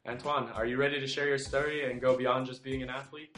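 loud, upbeat announcer welcome; podcast host intro. Acted. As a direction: open the show with big energy.

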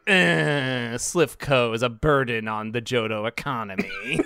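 droning mechanical Pokemon voice; Magnemite learning to talk. Acted. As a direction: whine it out like a creature powering down. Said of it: "This is what Magnemite sounds like".

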